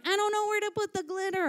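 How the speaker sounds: put-on high, fast little-kid whine; mock crying child. Child upset over an art project, mock crying. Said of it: "she starts crying 'cause she's like".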